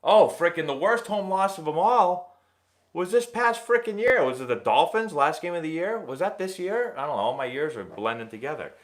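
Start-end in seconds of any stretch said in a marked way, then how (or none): 0.0-4.2 high, louder exasperated outburst; venting in pitched-up disbelief. Real, not acted. Exasperated rant about worst loss.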